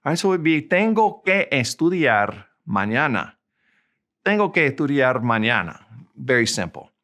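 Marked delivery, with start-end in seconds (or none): 0.6-3.3 deliberate, carefully spaced Spanish. Shown as a demonstration. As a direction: draw out "tengo que estudiar", then pause before "mañana". Slow model, then faster repeat.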